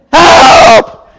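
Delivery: drawn-out, high, very loud holler; yelling for help. Acted. Drowning man calling to a boater, yelling. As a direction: bellow it across the water. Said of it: "So I start yelling".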